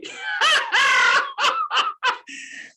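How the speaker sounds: a burst of real loud laughter; loud high laugh. Real, not acted. To the chat cheaters, a loud delighted laugh.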